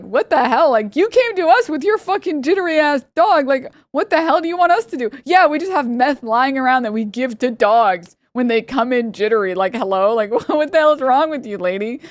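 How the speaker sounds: high-pitched, exasperated mock rant; imitated indignation, voice pushed up; retold outrage. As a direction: act out an incredulous comeback. Retold staff's outraged comeback to lady.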